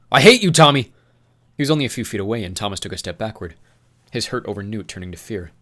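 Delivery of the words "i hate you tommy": furious yell. Acted.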